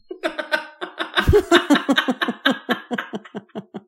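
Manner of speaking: long high-pitched laughing fit. Real, not acted. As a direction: keep laughing at the punchline. Laughs after telling the joke.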